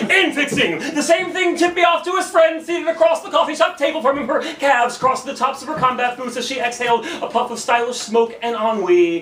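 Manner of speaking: high, driving spoken-word burst that eases down; slam poetry. Acted. Poet describing the punk's friend, slam poetry. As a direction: launch it high at the crowd, then settle.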